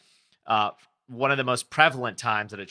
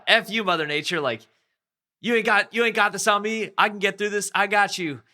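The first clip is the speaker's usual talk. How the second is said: much higher, louder fierce outburst; defiant angry yell; 10 semitones higher, 7 dB louder than usual. Retold or imitated. Surfer fighting the current, defiant angry shout. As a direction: shout down the ocean, refusing to quit. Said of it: "then the anger starts kicking in".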